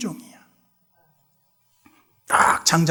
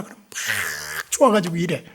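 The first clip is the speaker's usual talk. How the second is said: normal talking voice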